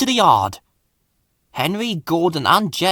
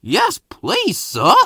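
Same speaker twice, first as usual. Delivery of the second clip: eager, high-pitched acceptance; excited; 10 semitones higher, slower than usual. A narrator's reading. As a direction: jump at the offer excitedly. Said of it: "Doc replied excitedly".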